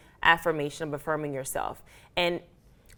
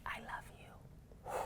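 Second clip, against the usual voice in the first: very slow, barely audible whisper; emotional whisper; 21 dB quieter, much slower than usual. Retold or imitated. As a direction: whisper it to yourself, overcome. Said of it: "I literally cried".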